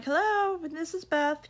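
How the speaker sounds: sing-song hello as she picks up; phone greeting. Retold or imitated. Beth picking up a call from a familiar number, bright and high.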